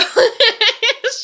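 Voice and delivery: high bursting laugh; loud burst of laughter. Real, not acted. Telling about her mad daughter, she bursts out laughing. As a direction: break into a big real laugh.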